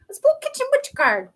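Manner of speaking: "Let's pull kitchen witch card" sung out high and bright; sing-song high call. Shown as a demonstration. Chanting to the viewers as the card is pulled, sing-song. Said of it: "Okay, let's pull kitchen witch card".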